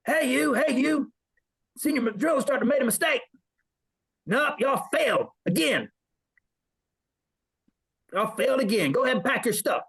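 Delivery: high mock drill instructor yell; hammed-up drill instructor barking at recruits. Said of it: "The junior hat comes out, it's like".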